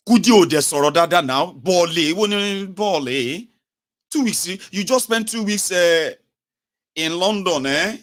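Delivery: high, loud indignant telling-off; exasperated, high-pitched mock rebuke; acted scolding. Seller scolds the fake-accented returnee.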